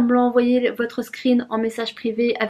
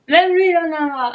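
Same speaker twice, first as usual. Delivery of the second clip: exasperated repeat; 5 semitones higher than usual. Shown as a demonstration. (répété, plus fort et exaspéré)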